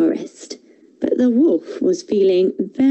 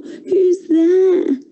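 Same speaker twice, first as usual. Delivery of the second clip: put-on higher, croaking old granny at the door; croaky sick grandma; 11 semitones higher than usual. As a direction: croak it like a frail ill grandma. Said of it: "so she croaked at the door".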